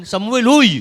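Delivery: acted exasperated call-out. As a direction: call someone to attention with a loud "hoy". God acted calling out to Samuel.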